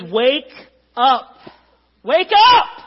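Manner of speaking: building to a high, loud yell; shouted wake-up. Acted. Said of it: "You think that's how he says it".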